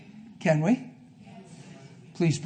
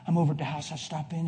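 ordinary talk

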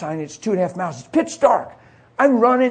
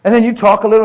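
normal talking voice

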